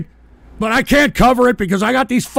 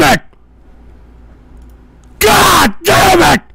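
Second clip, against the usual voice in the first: raging screams, much higher and louder; furious shouting; 10 semitones higher, 10 dB louder than usual. Real, not acted. Host screaming at trolls mocking his show, real rage. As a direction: explode at them in real fury.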